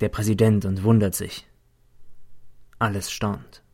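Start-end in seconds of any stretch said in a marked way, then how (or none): none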